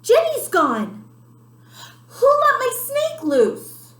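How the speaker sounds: high, loud alarmed shout; shouted high to the whole classroom; Emily. A narrator's reading. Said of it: "Emily shouts".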